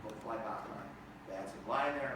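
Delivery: ordinary talk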